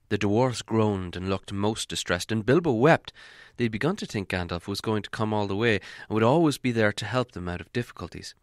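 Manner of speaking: ordinary delivery, nothing marked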